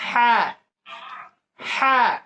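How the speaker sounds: "হ্যাঁ" with a strong breathy h from mid-throat; one short burst of laughter shaped into "হ্যাঁ". Shown as a demonstration. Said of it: "একটু হাসার আওয়াজ দিয়ে বলতে হবে". (হাসির আওয়াজ দিয়ে গলার মাঝখান থেকে "হ্যাঁ")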